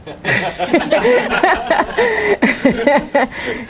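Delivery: long run of high chuckles; amused laugh. Real, not acted. Teacher amused midway through the lesson, long laugh. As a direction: laugh freely before carrying on. Amused while listing command endings.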